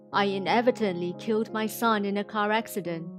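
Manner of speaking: normal talking voice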